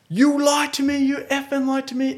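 high-pitched, hammed-up accusation; mock aggressive. Buyer going hell for leather at the seller, mock fury. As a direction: imitate an irate buyer, voice pitched right up. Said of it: "This guy's getting real aggressive".

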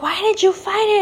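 high-pitched mock scolding; imitating mad mom. Mom confronts daughter after court, high imitated anger. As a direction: mimic an exasperated mom's demand. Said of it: "my mom was so mad".

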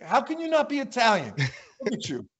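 high-pitched imitation of an appalled coach; retold outraged disbelief; mock incredulity.

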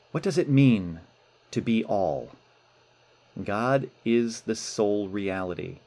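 solemn letter reading. Acted. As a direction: read with reverent weight.

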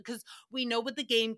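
ordinary talk

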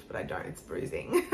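breathy laugh through the words. Real, not acted. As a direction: giggle it out. Laughs at her bruised lip.

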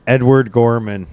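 ordinary talk

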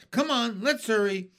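high, loud rushing call; eager hurry. Acted. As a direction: hurry everyone along. Crew scuttling off.

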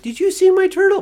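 put-on very high sweet little-girl voice; cute little girl. Parent mimicking the girl asking about her turtle, high and sweet. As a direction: squeak it like a tiny child. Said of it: "cute little girl's like".

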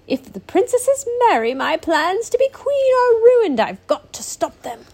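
high, loud, plotting duchess voice; scheming villain. Acted. Rowena fearing her plans are ruined, high and loud. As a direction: rant like a thwarted villainess.

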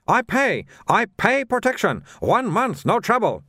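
high-pitched trader in broken English; protesting little shopkeeper. A narrator's reading. Shopkeeper protests to a watchman about paying, high indignant voice.

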